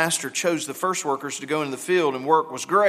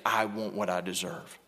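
softer, hushed echo of the demand; soft repeat; 10 semitones lower, 10 dB quieter than usual. Retold or imitated. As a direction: drop it to a quiet murmur. Same demand echoed softly before King.

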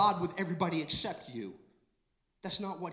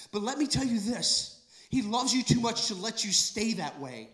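fervent preaching; 5 semitones higher, 5 dB louder than usual. Acted.

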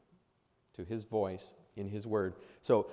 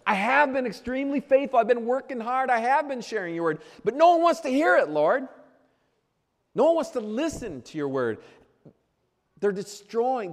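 much higher, louder pleading protest; Elijah's complaint; 10 semitones higher, 8 dB louder than usual. Acted. Elijah protesting to the Lord, high and pleading, fading at the end. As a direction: plead your case to God in a high complaining voice. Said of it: "Twice Elijah says".

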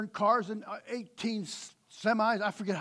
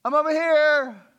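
loud, high hide-and-seek call; playful call; 8 semitones higher, 13 dB louder than usual. Retold or imitated. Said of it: "I said".

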